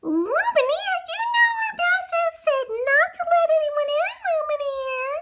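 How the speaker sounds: high, fast fussy scold; tut-tut like a fussy clock. Acted. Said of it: "scolded the candlesticks".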